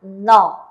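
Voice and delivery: no said loud and clear as a model reading; teacher models the syllable no. Shown as a demonstration. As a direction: read no out firmly like a teacher. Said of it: "que se pronuncia".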